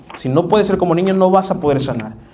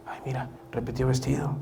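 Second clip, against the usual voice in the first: catty gossip; 3 semitones lower, 13 dB quieter than usual. Retold or imitated. Gossiping sister mocks another's dress.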